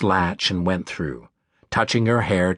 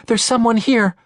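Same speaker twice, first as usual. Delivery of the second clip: high, louder alarmed announcement; rising panic in the voice; startled and scared; 12 semitones higher, 5 dB louder than usual. Acted. Finds man in trench.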